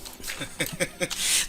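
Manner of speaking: voiceless, breathy chuckle; short run of airy chuckles. Real, not acted. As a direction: laugh lightly at your own remark. Laughs at own age remark.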